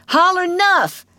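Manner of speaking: put-on high, loud boyish yell; triumphant yell. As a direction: yell it down at him from on top. Tom repeats his demand.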